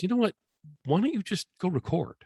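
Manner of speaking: high-pitched imitation of his wife; high mock wife voice; wife's voice.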